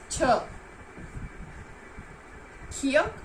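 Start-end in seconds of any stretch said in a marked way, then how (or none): 0.1-0.2 isolated sound छ, said clearly on its own; teacher sounding out छ; letter sound demo. Shown as a demonstration. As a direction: sound out छ by itself. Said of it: "की sound होती है".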